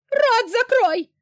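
a mother shrieking at her daughter to shut up; shrill shriek. A narrator's reading. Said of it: "взвизгнула женщина".

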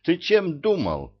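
high-pitched scolding outburst; angry rebuke. A narrator's reading. Ilya turns on the king over children, scolding. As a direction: lay into him sharply. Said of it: "обрушился он на короля".

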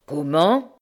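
a stunned outcry; stunned cry. A narrator's reading. Martin hearing he's owed nothing, stunned. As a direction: caught off guard, mild. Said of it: "s'écria Martin stupéfait".

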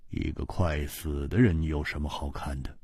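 low, soft, cold reply; Ji Bingyan's voice. A narrator's reading. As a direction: brush it off, unmoved.

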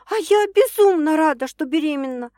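high, loud declaration; excited woman insisting; emphatic joy. A narrator's reading.

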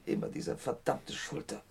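the shoulder flares up again; pained whisper. Acted. Verletzter klagt über Schulter. (gepresstes Flüstern vor Schmerz)

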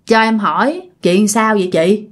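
low, careful respectful questions; father's polite questioning. Retold or imitated. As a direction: ask a possessed stranger politely, pitched low. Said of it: "ba mới hỏi rằng á là".

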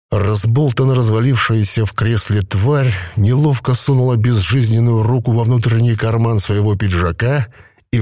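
ordinary delivery, nothing marked

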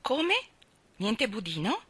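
put-on high, startled question from a boy; a child stunned there's no pudding; dismayed surprise. Michael protesting to Mary Poppins, high and startled.

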